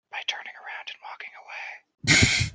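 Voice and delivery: hushed sneaky whisper. Acted. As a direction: breathe it out like sneaking up. Sneaky approach narrated in whisper.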